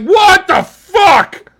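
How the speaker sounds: berro agudo e arrastado de pavor fingido; grito de susto. Pretend. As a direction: grita bem alto e devagar, como quem vê o próprio sósia. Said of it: "I would scream".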